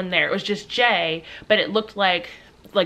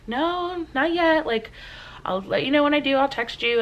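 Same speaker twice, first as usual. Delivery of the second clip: high-pitched, put-upon daughter on the phone; mildly impatient reply; 7 semitones higher than usual. Retold or imitated. Daughter driving, answering mom's check-in call, mildly impatient. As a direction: reenact fending off a parent's check-in, pitched up.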